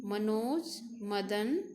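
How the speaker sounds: normal talking voice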